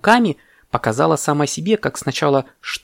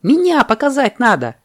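high, eager insistence; excited monkey; 11 semitones higher, 3 dB louder than usual. Acted. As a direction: burst out with high-pitched enthusiasm. Monkey eagerly volunteers itself.

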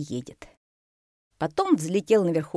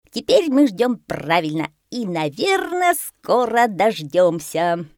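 triumphant parrot; 3 semitones higher, 7 dB louder, slower than usual. A narrator's reading. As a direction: announce it loudly, stretching the end. Попугай торжественно объявляет. (торжествующий попугай, громко и высоко)

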